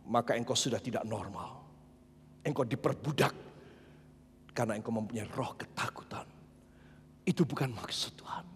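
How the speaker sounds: very soft, low, hushed warning; hushed and low after the shouting; sudden whisper. Acted. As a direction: drop right down to a grave whisper.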